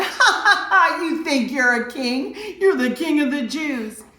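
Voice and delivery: high, loud jeering "ha, ha" into a sneer; mocking laughter. Soldiers taunting Jesus in his robe, loud mocking jeer. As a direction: taunt him like a cruel soldier.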